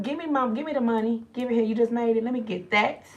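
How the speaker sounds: mock greedy kids grabbing the money; kids demanding money. Mother mimicking her kids demanding the cash. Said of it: "They're like".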